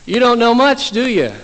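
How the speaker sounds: high, loud mock indignation; exasperated jab. Angry traveller taunts the old man.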